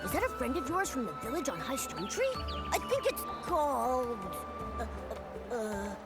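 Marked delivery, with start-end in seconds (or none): 2.7-6.0 trailing off, lower and much softer; groping for memory. Acted. Trying to recall the friend's village, hesitant. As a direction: let it sink into hesitant uhs.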